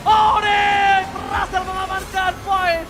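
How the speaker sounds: slow, drawn-out hype shouting; fighting game announcer. Real, not acted. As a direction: belt out each kill like an arena announcer. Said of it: "fighting game announcer, triple kill hype".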